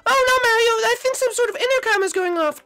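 very high, loud cartoon panic; panicked alarm. Character hears the emergency intercom going off, panicked. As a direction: yelp in falsetto at the sudden alarm.

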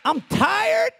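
very high, loud, slow preaching cry; preaching shout. Real, not acted. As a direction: shout it out to the congregation. Preacher climaxes on church shame.

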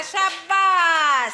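loud, long drawn-out bravo; cheering praise. Real, not acted. Teacher leading the class in praise, loud drawn-out cheer.

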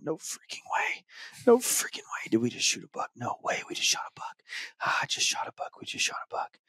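soft, unvoiced whisper of disbelief; whispering to himself. Boy whispering to himself walking through the woods, awed whisper. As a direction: breathe it to yourself like you can't believe it. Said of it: "he's talking to himself".

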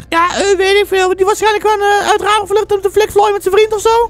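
very high, squeaky put-on female voice; high-voiced mother. Mother guesses to her son about missing Tracy, high-voiced. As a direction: play the mother in a falsetto.

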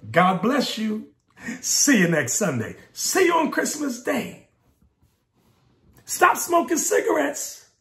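high-pitched imitation of pleasant churchgoers; mock churchgoers. Preacher mimicking Sunday-only Christians to his listeners, mocking high voice. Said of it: "All they want to say is".

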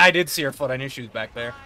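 normal talking voice

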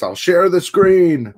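imitating a chatter offering to share, hammed-up; mock quote of a viewer. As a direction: ham up the viewer's line.

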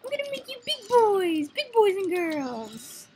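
big, high, stretched-out cheer for the babies; excited cooing. Real, not acted. Gamer promising to grow the little slimes big, excited cooing.

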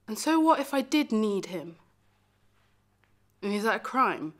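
pleading, indignant self-defence; acted wounded defiance; defiant hurt. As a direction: protest it, pushing the key words. Defends needing the one they loved.

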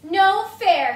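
high loud whine; pigeon complaining. Acted. Pigeon protesting to the kids, loud high whine. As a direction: protest like a sulky kid.

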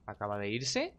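puzzled check with a rising end; tentative, questioning surprise. Real, not acted. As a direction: ask it in mild disbelief, voice lifting at the end. Puzzled first reaction to someone leaving.

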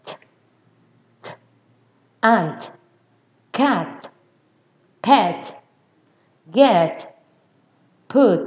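isolated T bursts, then words ending in a released T; tongue to teeth, pop each final T in "Ant, cat, pet, get, put"; popped final T. Shown as a demonstration. Teacher drilling beginners on final sounds, released T. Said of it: "Đưa lưỡi ra kề răng nha".

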